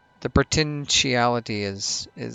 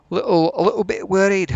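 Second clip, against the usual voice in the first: higher, louder, badly faked Scottish accent; bad Scottish accent, pitched up; terrible Scottish accent; 5 semitones higher, 5 dB louder than usual. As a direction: put on a hammy, unconvincing Scottish accent. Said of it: "That's a terrible Scottish".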